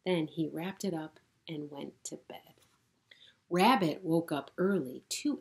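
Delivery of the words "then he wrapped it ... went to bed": plain reading voice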